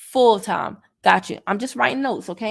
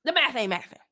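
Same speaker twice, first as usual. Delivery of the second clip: worked up. Real, not acted. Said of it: "Y'all can tell I'm getting worked up".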